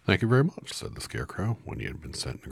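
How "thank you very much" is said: higher, louder thankful straw man. Acted. Scarecrow thanks Dorothy once more, pitched up and loud. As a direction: squeal the thanks with relief. Reread after skipping a page.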